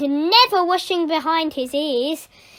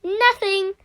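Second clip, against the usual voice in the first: feigned innocence; 7 semitones higher, 4 dB louder than usual. Acted. Pupil caught out, feigning innocence.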